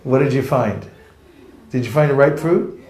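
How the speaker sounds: usual speaking voice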